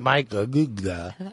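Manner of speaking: slow, deliberately mangled "Aguda"; garbled name. Acted. Host comically botches best friend's name, slow garbled "Aguda".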